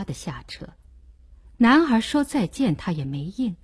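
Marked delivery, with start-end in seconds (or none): none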